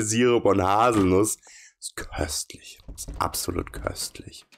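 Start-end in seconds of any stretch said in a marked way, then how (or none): 1.8-4.5 softer, breathless whisper of delight; dreamy whisper. Acted. Gushing over caramel coffee, dreamily whispered. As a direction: whisper it like you're savouring the sweetest coffee.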